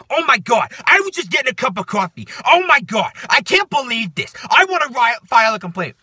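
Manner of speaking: loud, high New York hysterics; mock an outraged New Yorker; New Yorker accent. Woman threatening bosses with a complaint, hammed-up New York accent. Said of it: "like, New Yorker accent".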